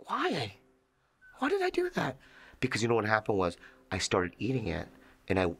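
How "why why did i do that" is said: high, baffled asking; bewildered. Retold or imitated. Comic waking up confused, asking his agents in high disbelief. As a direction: squeak it out in disbelief. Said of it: "And I go".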